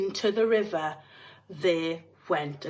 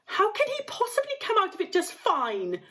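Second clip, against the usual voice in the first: high, sharp retort; mother snapping at Mister Wonka; snapped; 12 semitones higher than usual. A narrator's reading. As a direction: snap back at him. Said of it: "snapped Missus Gloop".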